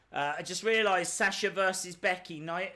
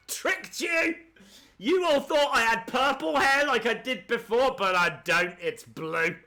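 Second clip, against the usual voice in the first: gloating wrestler; 5 semitones higher, 6 dB louder than usual. Retold or imitated. Voices Sasha taunting the crowd.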